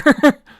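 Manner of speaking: sudden high burst of laughter; loud laugh. Real, not acted. Laughs at own folder.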